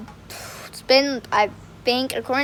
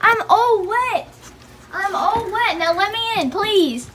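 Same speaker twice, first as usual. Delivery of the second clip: whiny pleading; 6 semitones higher than usual. Real, not acted. Soaked vlogger begging Jack to open up, whiny pleading. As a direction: plead pitifully in a raised pitch.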